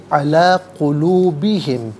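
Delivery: علی قلوبہم run together slowly; joined recitation. Shown as a demonstration. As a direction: chant علی قلوبہم as one phrase. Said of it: "ملا کر پڑھیں گے".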